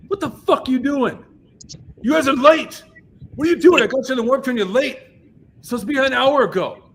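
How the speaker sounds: high-pitched angry yelling, imitated; loud, high impression of a furious rant; Fletcher. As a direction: chew out a late band at full volume. Fletcher berates the late band.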